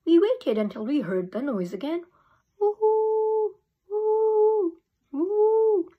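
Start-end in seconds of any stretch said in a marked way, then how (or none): 2.6-5.9 high, loud ghostly woo; howling woo. A narrator's reading. Girl imitating the dog's howl, high ghostly woo.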